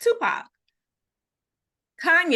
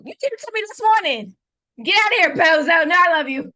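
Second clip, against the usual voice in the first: high, loud mock scolding; loud teasing re-enactment; mock outburst; 7 semitones higher, 10 dB louder than usual. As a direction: shout it in playful outrage, pitch way up. Teasing re-enactment of friend's morning message.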